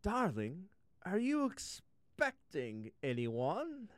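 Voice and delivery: high, slow, prim queen; a doting royal mother asking sweetly; queen's voice. Acted. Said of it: "and the queen said".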